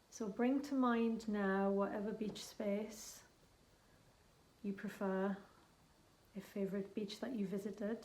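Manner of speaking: gentle guided visualisation; guided meditation. Acted. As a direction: ease the listener into the journey, unhurried. Leads a guided shamanic journey.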